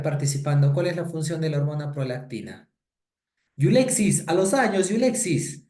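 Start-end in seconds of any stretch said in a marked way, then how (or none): 3.6-5.7 high, loud hail to a student; calling out. Real, not acted. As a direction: greet a long-lost student in a raised, high voice. Teacher hails a long-absent student. (saludo efusivo en voz alta y aguda)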